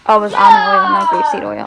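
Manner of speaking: sudden loud oh, high and excited; blurting out a correction; surprised realization. Real, not acted. Sudden self-correction, much louder.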